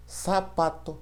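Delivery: "Sapato" hissed with S; Spanish S-like Z. Shown as a demonstration. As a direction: say "Sapato" with an S sound. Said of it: "ela sempre terá som de S".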